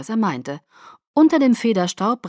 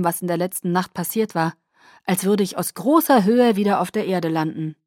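ordinary delivery, nothing marked

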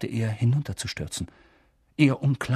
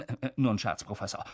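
suddenly much softer, apologetic; sheepish retreat; 3 semitones lower, 8 dB quieter than usual. Acted. Lee apologising to the professor, sheepish and hushed. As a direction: drop it quickly, caught out.

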